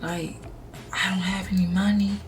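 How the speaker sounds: hushed, near-whispered imitation of a man; talking real low. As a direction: drop to a very soft, sheepish murmur. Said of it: "talking real low and was like".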